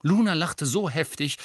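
ordinary delivery

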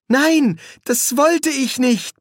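high, loud, anguished protest; desperate plea. A narrator's reading. Boy takes back his command to frightened friends, desperate. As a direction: cry out in horror at what you did. Adam horrified, pleads with friends.

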